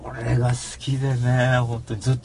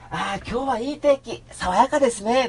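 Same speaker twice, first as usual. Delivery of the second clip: high-pitched, mock-breezy pleasantry; cheery small talk; 12 semitones higher than usual. Cheerful person remarking on fine weather, high mock-pleasant voice. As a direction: lift it high like a wholesome weather greeting. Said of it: "なんて言ってると".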